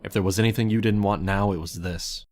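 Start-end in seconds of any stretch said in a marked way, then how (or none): none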